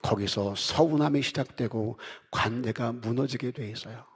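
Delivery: normal talking voice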